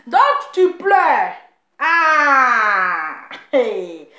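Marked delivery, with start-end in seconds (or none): 1.8-3.3 mock wail. Mocking the crying woman.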